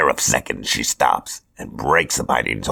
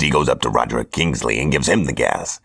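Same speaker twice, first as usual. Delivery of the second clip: usual speaking voice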